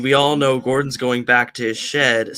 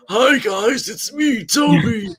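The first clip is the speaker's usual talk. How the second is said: loud, high mock shouting intro; hammed-up yelling greeting; silly over-the-top voice; 6 semitones higher, 6 dB louder, slower than usual. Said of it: "he can't go like".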